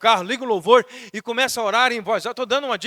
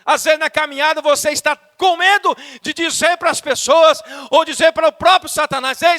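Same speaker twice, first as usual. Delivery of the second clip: raised, insistent pulpit voice; fervent preaching; 7 semitones higher, 6 dB louder than usual. Real, not acted. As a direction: preach it high and forcefully. Preaches at full force. (pregação fervorosa em voz elevada)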